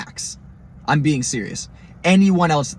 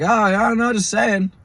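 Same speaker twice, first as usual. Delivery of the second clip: high-pitched mock backpedaling; imitating a fake-casual denial; feigned innocence; 9 semitones higher, 4 dB louder, slower than usual. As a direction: brush it off in a raised, innocent voice. Tow guys backpedal after veiled warning.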